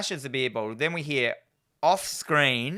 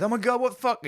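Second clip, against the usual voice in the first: high, lightly panicked; a touch of freaking out; mock panic; 10 semitones higher, faster than usual. Podcaster quoting the hero's panic, high. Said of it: "He goes".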